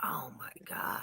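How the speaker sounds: hushed, whispered amazement; awed whisper. Real, not acted. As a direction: whisper it under your breath in wonder. Amazed at seeing the snake's eye.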